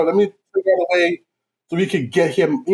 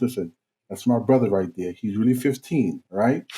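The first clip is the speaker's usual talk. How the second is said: low confiding aside; 7 semitones lower, 6 dB quieter than usual. Retold or imitated. Confiding low to shop girl.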